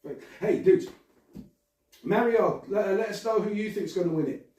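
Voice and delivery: ordinary talk, nothing marked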